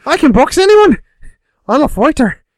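shrieking high, loud leprechaun Irish; Conor McGregor. Acted. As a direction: play a frantic Irish leprechaun boasting at full pitch. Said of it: "impression of Conor McGregor's like a leprechaun on crack".

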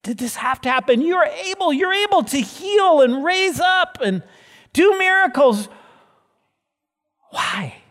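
high, loud, pleading why; anguished crying out. Acted. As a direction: cry out to God in anguish. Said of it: "you crying out to him and saying".

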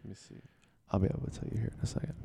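fast and under the breath; muttering. Real, not acted. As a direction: mutter it while looking something up. Muttering while checking phone.

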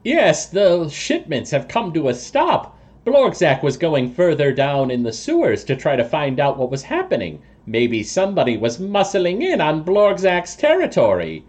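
high, lofty toad-man voice; toad person. Acted. Toad person excuses his sewer trips, high and lofty. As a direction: explain it evasively in a high creature voice. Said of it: "But he says".

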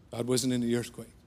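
usual speaking voice

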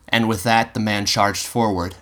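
plain reading voice

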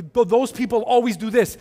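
mock polarizing gripe. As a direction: sneer at those people. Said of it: "to be".

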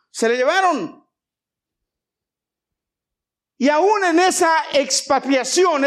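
high, emphatic pulpit voice; forceful preaching. Real, not acted. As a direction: preach it out, stretching the key words.

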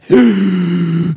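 sharp, high gasp of shock. Retold or imitated. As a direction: gasp like the blood drains out. Seeing weed on counter.

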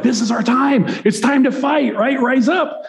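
high, fired-up call to fight; rally the others like it's finally time; eager battle cry. Retold or imitated. Disciple rousing the others to fight, excited.

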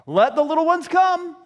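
high, loud insistent decree; emphatic command. Acted. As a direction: proclaim it firmly to the whole group. Restated command, first loud.

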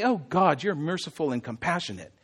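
soft, low admiring praise; complimenting. Shown as a demonstration. What Jonah is not doing: complimenting God softly. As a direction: gently murmur a compliment to God. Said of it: "Jonah is not complimenting God".

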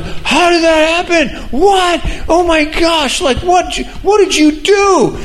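put-on very high, astonished child's voice; boy freaking out over his healed knee; amazed kid. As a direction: squeal it like a kid who can't believe he's healed.

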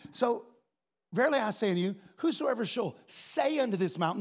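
ordinary talk, nothing marked

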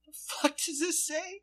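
high-pitched baffled squeak; mock bewilderment. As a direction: sound thrown by the strange wrapper. Parent reading weird candy.

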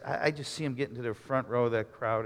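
ordinary talk, nothing marked

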